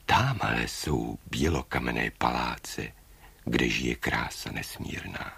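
low, soft bird voice; hushed pointing-out. A narrator's reading. Bird shows the prince the distant palaces, hushed. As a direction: reveal the place quietly.

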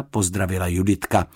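usual speaking voice

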